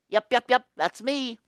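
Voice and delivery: lightly voiced goofy penguin; chirpy proud yep; Tacky voice. Tacky admitting he is an odd bird.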